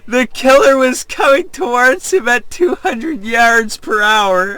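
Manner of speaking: high breathless laughing read; laughing reread. Real, not acted. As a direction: try to read it again while cracking up.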